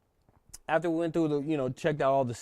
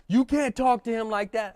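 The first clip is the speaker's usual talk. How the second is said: raised, louder protest at the speaker; angry retort; 7 semitones higher, 6 dB louder than usual. Retold or imitated. Inmates scolding the speaker, a loud angry retort. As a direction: snap back in defense of their leader.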